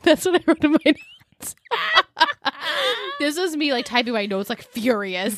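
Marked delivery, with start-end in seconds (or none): 1.7-3.1 real high laugh; high burst of laughter. Real, not acted. Host laughing at her furious notes, real laugh. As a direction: break into laughter.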